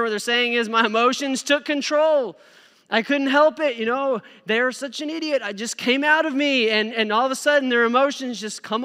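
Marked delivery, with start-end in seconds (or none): 0.7-6.7 higher, louder, hammed-up excuse-maker; mock whiny excuse. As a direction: imitate someone blaming their feelings. Mocking people excusing outbursts.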